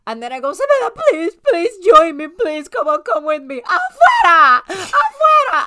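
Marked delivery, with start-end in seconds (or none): 0.5-3.6 high-pitched, over-emotional begging; mock tearful pleading. Mother re-enacting begging her daughter to join her, mock-desperate pleading. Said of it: "I am full in tears". 3.6-5.6 high, loud, wailing shout; loud re-enacted cry. Re-enacting driving bad energy out the door, wailing shout. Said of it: "I'm being really loud".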